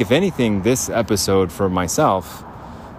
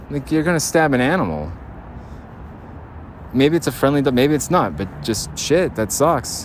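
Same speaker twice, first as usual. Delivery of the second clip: ordinary talk, nothing marked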